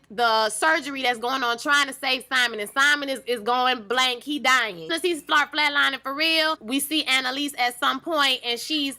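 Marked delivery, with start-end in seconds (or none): none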